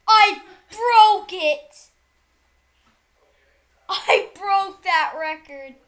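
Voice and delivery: slow, loud victory yell; triumphant shout. Real, not acted. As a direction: roar it out, you finally did it. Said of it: "Quit screaming".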